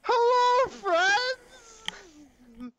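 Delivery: high, drawn-out yelled greeting; loud friendly yell. Acted. Player's character hailing all the creatures around him, loud high yell. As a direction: holler it out high, stretching each word. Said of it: "he yells".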